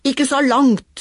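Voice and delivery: mild shout. Acted. Toy Knerten shouting from his boat, mildly alarmed. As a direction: call out in alarm across the pond. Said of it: "skrek Knerten".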